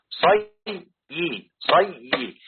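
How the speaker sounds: صيي split slowly into "say... yi"; syllable-by-syllable pronunciation. Shown as a demonstration. Teacher drilling the doubled ya, slow syllable-by-syllable. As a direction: stretch صيي as two separate syllables, "say-yi". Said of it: "يبقى تنطق النطق الصحيح".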